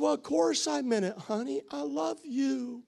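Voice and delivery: husband sweet-talking his upset wife, mock tender. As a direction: coax her with honeyed words.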